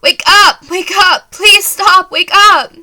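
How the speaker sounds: high, desperate screams; screaming, begging. Acted. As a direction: shriek at him to wake up, frantic. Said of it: "begging you to wake up".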